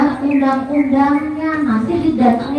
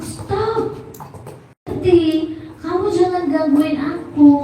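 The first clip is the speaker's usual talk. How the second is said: put-on high, softer child's "stop"; voiced child Piko; 6 semitones higher, 3 dB quieter than usual. Child telling the bully to stop bothering, high soft protest. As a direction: lightly act a child objecting, pitched up. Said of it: "Pikonya bilang".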